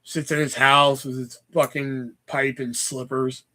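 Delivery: ordinary talk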